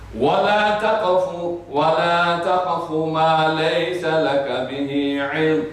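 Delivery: melodic tajweed chant, quick through the middle; Quran recitation. Acted. Woman rebuking the man with a chanted verse.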